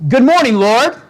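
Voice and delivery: bright, high, eager good morning; cheerful greeting. Retold or imitated. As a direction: greet the day with joy. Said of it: "some people wake up in the morning, all right, and say".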